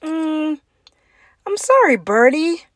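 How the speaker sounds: a hint of a high, sympathetic teacher; sorry teacher. A narrator's reading. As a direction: break the bad news kindly. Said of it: "said teacher".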